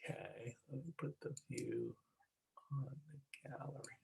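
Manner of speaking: unvoiced whisper while fiddling with settings; whisper to self. Real, not acted. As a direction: whisper it under your breath, half to yourself. Host muttering to herself at computer.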